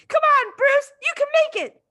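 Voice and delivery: very high, loud cheering call; excited shout. A narrator's reading. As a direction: cheer him on from the crowd. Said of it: "someone shouted".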